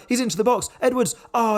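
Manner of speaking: excited commentary call; rising excitement. Real, not acted. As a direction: build the call toward the chance. Late chance building.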